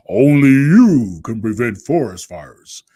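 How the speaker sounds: loud, booming mascot impersonation; put-on character voice, drawn out and loud; Smokey Bear. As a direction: boom it out slowly as a big cartoon bear's slogan. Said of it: "that's my Smokey Bear impersonation".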